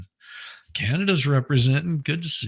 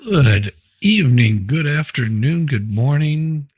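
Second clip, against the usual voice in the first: loud greeting; 2 semitones higher, 7 dB louder than usual. Real, not acted. Host greeting his live chat.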